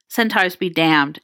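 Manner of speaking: ordinary delivery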